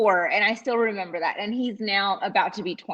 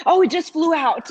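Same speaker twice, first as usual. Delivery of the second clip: put-on excited kid; 8 semitones higher, 6 dB louder than usual. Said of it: "he's like".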